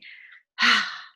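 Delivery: loud fed-up exhale; loud frustrated sigh. Shown as a demonstration. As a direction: heave it out, exasperated. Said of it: "that sigh of frustration".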